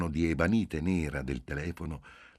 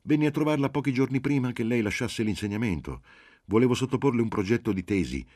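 higher, louder, pressing his case; raised, urgent reminder; insistent; 7 semitones higher, 5 dB louder than usual. A narrator's reading. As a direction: insist eagerly, jogging the professor's memory. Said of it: "insistette Marcello".

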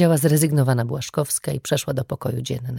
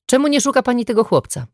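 accusing; 8 semitones higher, 3 dB louder, faster than usual. Acted. Mother accusing the detective.

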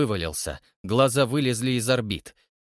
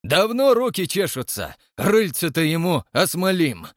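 put-on high, loud old man threat; gruff old man; 7 semitones higher, 5 dB louder than usual. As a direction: spit out the threat gleefully. Yegor Kuzmich threatens.

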